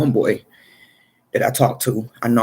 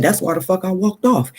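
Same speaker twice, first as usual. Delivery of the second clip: higher, pointed told-you-so; vindicated; 7 semitones higher than usual. Retold or imitated. Telling the friend why he left, higher and pointed. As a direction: drive it home that you were right. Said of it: "I said".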